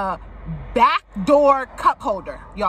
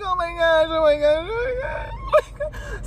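high-pitched panicked oh my gosh, rising higher; retold panic; 5 semitones higher than usual. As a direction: squeal it like a bug is in your car. Retold panic in car with bug.

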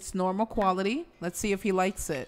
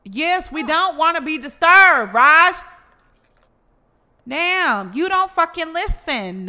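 loud, high fed-up shout; exasperated yell; 8 semitones higher, 9 dB louder than usual. Real, not acted. Gamer yelling at neighbor Raj, loud and exasperated.

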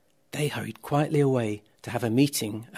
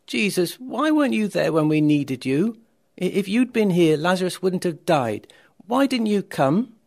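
raised, louder, pained pleading questions; upset reproach; 5 semitones higher, 6 dB louder than usual. A narrator's reading. Martha to Jesus after her brother died, upset reproach. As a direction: demand to know why he wasn't there.

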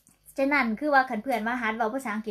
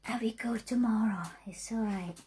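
whispered English; 5 dB quieter, much slower than usual. Real, not acted. Quiet English practice to herself.

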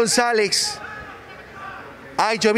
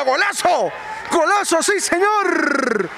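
goal commentary; 7 semitones higher, slower than usual. Real, not acted. Goal called in live commentary.

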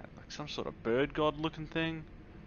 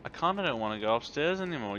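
ordinary talk

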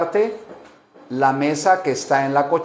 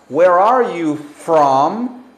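slow model question; 3 dB louder, slower than usual. Shown as a demonstration. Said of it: "¿Cómo les preguntan".

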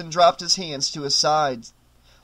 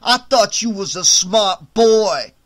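menacing sneer; 4 semitones higher, 8 dB louder, slower than usual. A narrator's reading. Tony threatening.

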